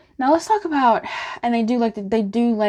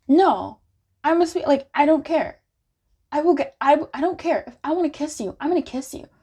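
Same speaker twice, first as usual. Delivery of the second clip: higher, bouncy mock-bossy voice; playful insistence; 7 semitones higher than usual. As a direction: tease them like a spoiled sweet talker. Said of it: "this energy of".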